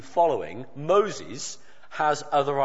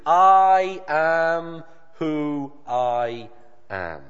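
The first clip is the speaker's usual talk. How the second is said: patient, mighty God; much slower than usual. Retold or imitated. God patiently answering Moses, slow and majestic. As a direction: declare it slowly and grandly, like God naming himself. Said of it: "God responds again patiently, mightily and gloriously".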